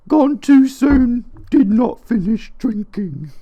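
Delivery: high, slow mock mourning voice; mock solemn epitaph. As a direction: read it like a grave inscription.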